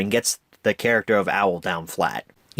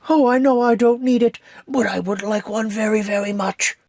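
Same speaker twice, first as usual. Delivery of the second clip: sing-song little bear, a lot higher; Pooh Bear voice; 9 semitones higher than usual. Retold or imitated. Reviewer quoting Pooh asking for a red balloon, high Pooh voice. Quoting Pooh's line from the movie.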